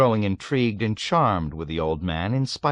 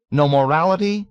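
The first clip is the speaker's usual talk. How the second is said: much higher, louder indignant demand; outraged question; 9 semitones higher, 7 dB louder than usual. A narrator's reading. Nately demands principles from the old man, outraged. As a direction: challenge him, scandalised.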